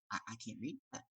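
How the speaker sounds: very soft, halting admission; acted sheepish undertone; quiet aside. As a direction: murmur it as if not to be overheard. Retold aside in front of audience.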